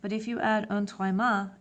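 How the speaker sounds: ordinary talk, nothing marked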